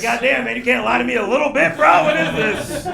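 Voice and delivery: loud, high, indignant protest; acted exasperation. Comic protests to an unimpressed fan, loud mock indignation. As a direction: complain at full volume, playing wounded. Acts out own indignant reply.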